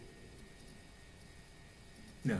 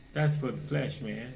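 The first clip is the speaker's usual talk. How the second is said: ordinary delivery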